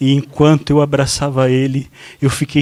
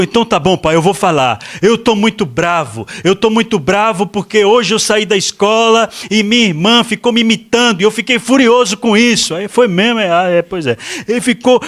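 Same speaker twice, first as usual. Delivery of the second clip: put-on high, louder kid voice pouring out complaints; upset young son; 8 semitones higher, 4 dB louder than usual. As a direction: play the boy venting his grievance to dad. Son explains why he's angry. (filho pequeno chateado, agudo e mais alto)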